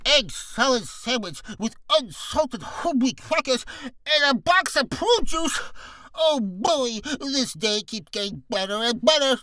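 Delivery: high, slow droopy voice, overjoyed; slow, elated cartoon sad-sack. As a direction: drawl it happily in a droopy cartoon voice. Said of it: "droopy voice ... he's very happy".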